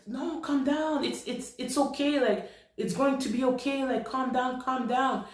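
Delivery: high-pitched retold plea to settle; urgent soothing. Friend tries to calm her crying.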